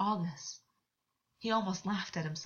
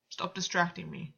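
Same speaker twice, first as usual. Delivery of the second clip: rough. Acted. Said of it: "Gwen said roughly".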